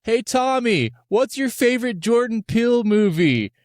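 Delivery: high, loud heckler's call; shouting at screen. Retold or imitated. Moviegoer heckling Tommy on the screen, shouted question. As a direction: call it out across a crowd. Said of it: "he ended up shouting to the screen".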